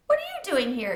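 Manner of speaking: startled to see him outside school; a voiced kid, pleased and surprised; surprised greeting. Israel greets Bat, surprised.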